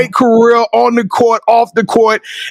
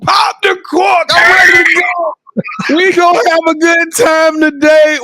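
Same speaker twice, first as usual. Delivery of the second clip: hype shout; 6 semitones higher than usual. Real, not acted. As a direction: fire up the crowd. Hype opening of the interview.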